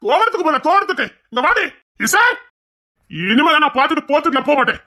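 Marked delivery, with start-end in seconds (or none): 0.1-2.4 put-on furious shout at a naughty girl; angry yelling. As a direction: yell at her to come outside.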